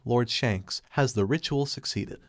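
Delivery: usual speaking voice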